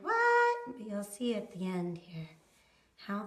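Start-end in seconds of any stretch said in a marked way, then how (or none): 0.0-0.6 high, loud mock shock; a sudden high what. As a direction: act out the viewer's jolt. Viewers' imagined reaction.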